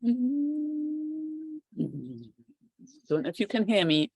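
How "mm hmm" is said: throat breath. Shown as a demonstration. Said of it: "let's try some Ujjayi breath".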